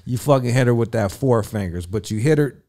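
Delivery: ordinary talk, nothing marked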